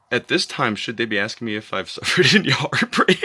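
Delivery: loud, rising into high-pitched laughter; laughing incredulity. Acted. Mid pretend heart attack asking about heartbreak, laughing in disbelief. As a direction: break up laughing as the question ends.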